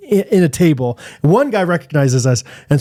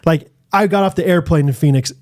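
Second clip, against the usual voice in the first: normal talking voice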